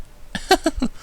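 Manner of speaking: high, breathy merry giggle. A narrator's reading. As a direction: giggle at his stare. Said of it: "she giggled merrily".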